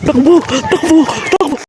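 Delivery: panicked high yells to run; shouting. Real, not acted. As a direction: scream at everyone to run. Fleeing the morgue.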